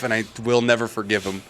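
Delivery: ordinary delivery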